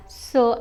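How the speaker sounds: "ce" with an s sound; soft c. Shown as a demonstration. Teacher showing how cédille reads, "ce" with soft s sound. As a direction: say "ce" like French se, soft c. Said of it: "you simply read it as".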